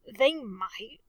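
ordinary delivery

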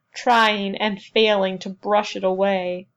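ordinary delivery, nothing marked

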